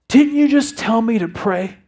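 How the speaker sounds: high, loud, drawn-out questioning; imitating an impassioned son; earnest son confronting dad. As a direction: press dad with slow, insistent questions. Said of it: "He looks me in the eye and says".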